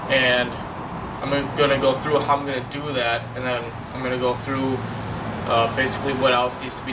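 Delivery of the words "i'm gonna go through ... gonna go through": ordinary delivery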